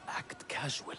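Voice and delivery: furtive whisper; soft, secretive undertone; whispered aside. Acted. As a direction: whisper it so nobody else hears. Secret aside while slipping away unseen.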